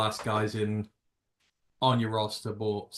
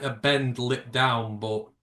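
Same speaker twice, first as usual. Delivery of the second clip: usual speaking voice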